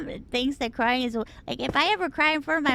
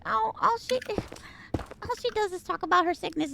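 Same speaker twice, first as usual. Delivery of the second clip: high-pitched mimicry of a complainer; mock complaint in a higher voice; mocking commenters; 8 semitones higher than usual. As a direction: imitate a whiny online critic, pitched up. Mimicking dismissive online commenters.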